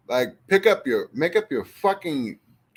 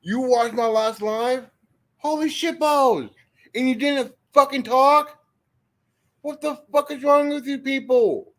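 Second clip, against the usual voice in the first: very high-pitched, astonished exclaiming; incredulous outburst; 13 semitones higher, 3 dB louder than usual. Real, not acted. Streamer learning a silent viewer watched the last live, incredulous. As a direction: exclaim in high, escalating disbelief.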